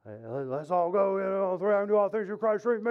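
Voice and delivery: garbled mock locker-room rant, rising; football coach pep talk. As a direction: imitate a coach revving up the team. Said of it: "Listening to a football coach".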